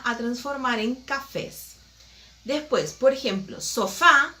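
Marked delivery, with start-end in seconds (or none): 3.6-4.3 sofá, punched louder on the final á; so-FÁ, weight on the last syllable; stress on final syllable. Shown as a demonstration. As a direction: land firmly on the FÁ of sofá. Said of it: "está acentuado en A".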